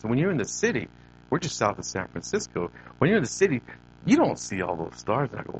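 ordinary talk

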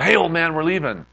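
loud, high call to someone in another room; retold yell. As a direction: yell it out as a summons. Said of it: "And I just yelled".

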